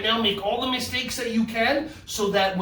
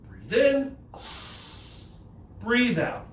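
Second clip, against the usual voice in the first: normal talking voice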